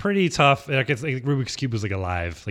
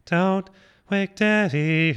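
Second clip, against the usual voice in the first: put-on slow, eerie child ghost; 6 semitones higher, much slower than usual. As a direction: drag it out like a haunting child. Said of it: "singing that in a ghost voice".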